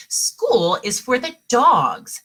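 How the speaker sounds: each word pressed, slow and loud; mama lays down the law; emphatic. A narrator's reading. Mama telling Skippy school is for dogs, emphatic. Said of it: "stated Mama Junebug Jones emphatically".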